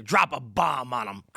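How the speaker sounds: lightly voiced wartime bomber, low and drawn out; gruff-talking bomber. Host mimics men who won World War Two, mock bomber voice.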